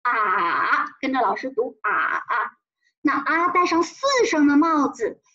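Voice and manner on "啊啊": slow 啊 dipping down, then rising; drawn-out dipping 啊; third tone. Shown as a demonstration. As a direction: bend 啊 downhill and back up. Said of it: "戴上了它三声的帽子".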